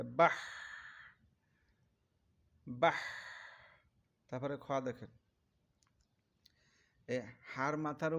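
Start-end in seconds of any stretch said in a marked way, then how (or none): 0.0-3.0 বাহ ending in an audible puff of air; airy বাহ. Shown as a demonstration. Teacher isolating breathy বাহ for learners. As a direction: push breath out after বাহ. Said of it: "এরকম বাতাস বেরিয়ে যাবে মুখ দিয়ে".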